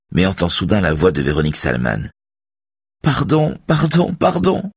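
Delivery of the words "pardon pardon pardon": high, frightened pleading, drawn out; scared woman's high-pitched plea; trembling with fear. A narrator's reading. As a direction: beg in terror at the top of the voice. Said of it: "Sa voix tremble de peur".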